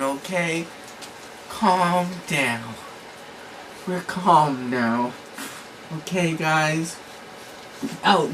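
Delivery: low, calm soothing tone. Real, not acted. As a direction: settle them down gently for a nap. Settling pets for nap time.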